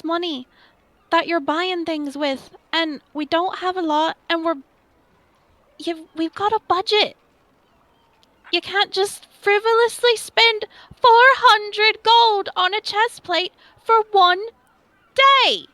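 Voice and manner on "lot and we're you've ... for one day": exasperated scolding. Acted. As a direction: scold him for wasting money. Scolding the dragon about money.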